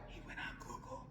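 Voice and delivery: very soft, voiceless whisper; hushed stage whisper; whispered aside. Pretend. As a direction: whisper the secret behind his back. Whispered aside exposing the trick.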